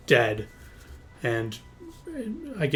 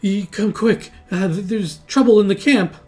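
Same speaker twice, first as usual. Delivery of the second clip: higher, louder urgent cry; alarmed messenger; 8 semitones higher, 7 dB louder than usual. Acted. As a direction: burst in calling for help. Game master voices alarmed messenger.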